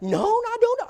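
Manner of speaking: high falsetto imitation of an excited lady; mock high woman's voice. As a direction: squeak it out like a delighted Southern lady. Brenda answering at the door.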